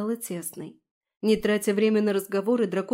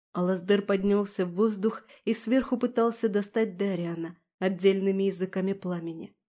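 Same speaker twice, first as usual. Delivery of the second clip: ordinary delivery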